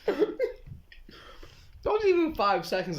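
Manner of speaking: slow, laughing through words. Real, not acted. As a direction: giggle through the whole line. Laughing after silence.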